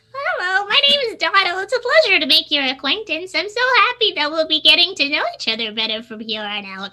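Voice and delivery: high, very polite goblin voice, amped-up Canadian; polite goblin princess. Acted. Goblin princess introduces herself to other characters, polite Canadian goblin voice. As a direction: introduce yourself sweet-mannered in a high dumb goblin voice. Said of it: "I speak very polite, and I really amp up the Canadian".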